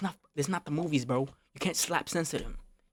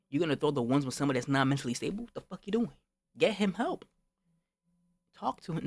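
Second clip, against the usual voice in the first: incredulous scolding; 3 dB louder than usual. Retold or imitated. Telling off a friend for picking a fight, incredulous scolding. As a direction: scold a friend, voice climbing in pitch.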